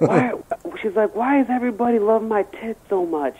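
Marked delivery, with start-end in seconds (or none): 0.0-0.3 mock exasperated prom date, high; exasperated girl. Imitates annoyed prom date. 1.1-3.4 mock whiny complaint, pitched up; exasperated girl. Imitates annoyed prom date.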